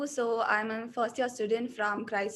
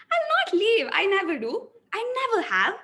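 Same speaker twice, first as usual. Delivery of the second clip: higher and louder, full of drive; energetic, raised voice; 7 semitones higher, 5 dB louder than usual. Shown as a demonstration. Acting student's energetic take of the same lines. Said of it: "So I'll go with the second one".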